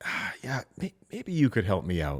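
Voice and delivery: gentle breathy ask for a favor; hesitant soft ask. Shown as a demonstration. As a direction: sound a little stuck and humble. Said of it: "you simply wanna say it just like this".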